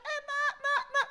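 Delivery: put-on high, laughing call to the child; laughing mock call. Parent calling the child off the stage, high and laughing. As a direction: call the kid back, half laughing. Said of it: "orang tuanya sudah teriak".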